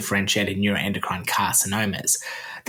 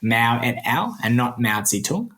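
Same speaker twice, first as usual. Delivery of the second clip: usual speaking voice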